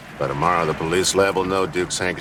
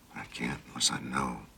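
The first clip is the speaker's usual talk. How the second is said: soft urgent whisper; 2 semitones lower, 11 dB quieter than usual. Acted. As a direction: plead in a hushed whisper. Urgent late night plea.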